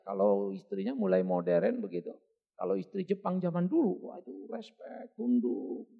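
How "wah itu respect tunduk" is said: very soft, slow, near whisper; soft, slow, almost whispered; hushed. Retold or imitated. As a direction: hush it like a bowing wife. Describing a demure Japanese wife.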